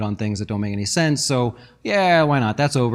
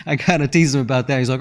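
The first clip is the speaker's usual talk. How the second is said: ordinary talk, nothing marked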